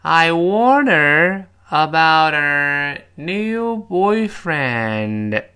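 loud, drawn-out choral model; slow read-along. Shown as a demonstration. Teacher leading students together, slow and loud. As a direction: stretch each word so a class can join. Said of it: "我们再跟老师一块儿来".

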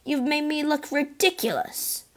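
indignant wail of wounded pride; bellowed. A narrator's reading. Porthos rages at the boy who shamed him, high bellow. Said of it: "Porthos bellowed".

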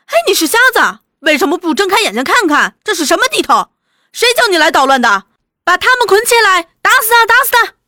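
high, loud, fast abuse; angry crowd cursing. A narrator's reading. Villagers cursing the captured intruders, angry shouting. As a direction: shout them down, building to a yell. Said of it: "下下目人个个在骂".

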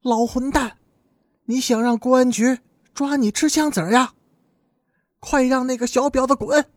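high-pitched cursing without extra volume; angry scolding. A narrator's reading. Sister cursing her father from inside the room, angry scolding. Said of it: "贺二根的姐姐在屋里骂道".

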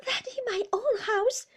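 high, loud aggrieved protest; complaining wife. A narrator's reading. Mother protesting to her husband about her house, high and aggrieved. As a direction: pitch it up as the wronged mother.

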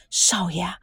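unvoiced, hushed whisper; a servant's nervous whisper. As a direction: lean in and whisper it. Servant breaking bad news.

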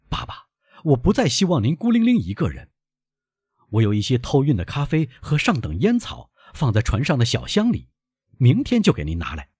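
put-on high-pitched, lively young man; eager young son. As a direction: insist brightly on looking after your father. Eager son plans father's comfort.

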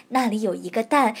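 usual speaking voice

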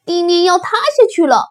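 high, loud, rapid alarm; panicked cry. Acted. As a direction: shout a frantic warning. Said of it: "它叫道". (惊慌急促地大喊)

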